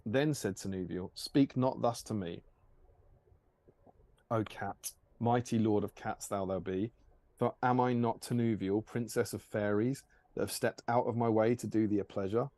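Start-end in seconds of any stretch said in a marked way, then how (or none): none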